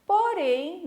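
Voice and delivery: ordinary talk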